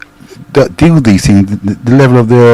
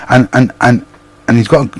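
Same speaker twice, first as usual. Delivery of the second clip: ordinary delivery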